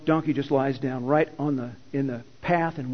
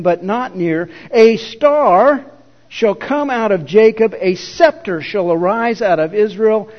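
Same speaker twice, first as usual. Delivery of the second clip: louder, slow and proclaiming, rising on A star; solemn scripture reading; 5 dB louder than usual. Acted. Preacher reading Balaam's prophecy to the congregation, solemn and proclaiming. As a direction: declaim the prophecy like an oracle.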